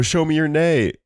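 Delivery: high call to the crowd; crowd hype call. Retold or imitated. Calling to the festival crowd, high hype. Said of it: "I'd go".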